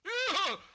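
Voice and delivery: very high, loud yelp of horror; shrill horrified ugh; ogre yelp. Acted. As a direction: yelp in disgust and fright. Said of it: "yelped the ogre in horror".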